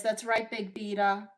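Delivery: normal talking voice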